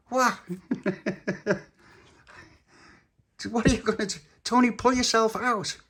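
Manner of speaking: high little puppy voice; squeaky puppy. Acted. Puppy character voice.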